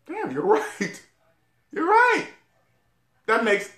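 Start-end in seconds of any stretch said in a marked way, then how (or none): 0.0-2.3 imagined realization. Retold or imitated. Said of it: "I would have said".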